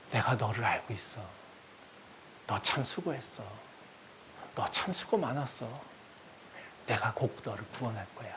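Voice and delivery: hushed, tender consolation; soothing God. Acted. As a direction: reassure quietly, with long pauses. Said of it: "이렇게 하면요, 아마도 우리 마음은 너무나도 편해질".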